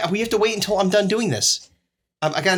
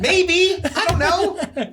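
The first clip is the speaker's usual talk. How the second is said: exasperated yelling; 12 semitones higher, much slower than usual. Real, not acted. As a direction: holler it, dragging out the last words. Exasperated shouting at co-host's questions.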